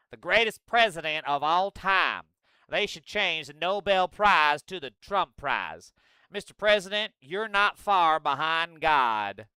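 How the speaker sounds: slow, loud mock-Southern flattery; fawning senator impression. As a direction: draw out the praise in a hammed-up drawl. Acts out Graham fawning over Trump.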